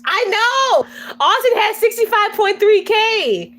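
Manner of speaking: high, excited exclamation. Real, not acted. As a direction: burst out in excited agreement, voice way up. Excited reply about Austin's following.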